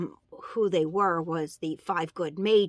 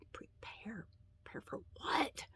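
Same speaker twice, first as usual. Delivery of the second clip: puzzled whisper; 3 semitones higher, 16 dB quieter than usual. Real, not acted. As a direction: breathe it out, bewildered. Stunned reaction on waking.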